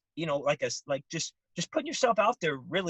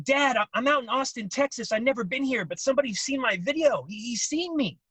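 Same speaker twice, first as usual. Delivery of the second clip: excited son; 6 semitones higher, 3 dB louder than usual. Retold or imitated. Son calls dad from tour.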